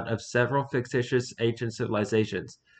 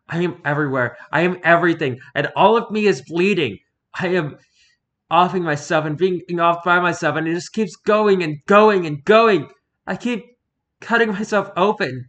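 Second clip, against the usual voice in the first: higher and much louder, building to a desperate wail; frantic rising cry; 7 semitones higher, 8 dB louder than usual. Acted. The bleeding book cries out to the researchers, rising and frantic. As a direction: let the horror pour out louder and louder.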